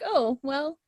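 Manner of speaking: normal talking voice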